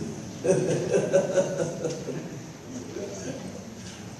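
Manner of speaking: long, wheezy chuckle at himself; real, drawn-out laugh. Real, not acted. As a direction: laugh sheepishly at your own confession. Laughs at his own confession.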